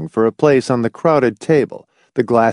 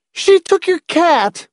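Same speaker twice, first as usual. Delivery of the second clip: put-on very high, drawn-out child's accusation; shrill accusation; 3 dB louder, slower than usual. A narrator's reading. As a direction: pipe up shrilly, pointing the blame. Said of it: "spoke up shrilly, in a loud, accusing voice".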